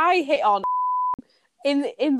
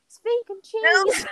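high, drawn-out quote of a friend; imitating drunk friend; 9 semitones higher, slower than usual. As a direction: mimic her slow and high, amused. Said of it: "and you were going".

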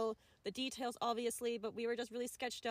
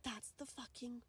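slow, hushed re-enacted whisper; secretive whisper; 12 dB quieter, slower than usual. Camper recognizes the car's driver, whispering to friends. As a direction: whisper it slowly so the driver can't hear.

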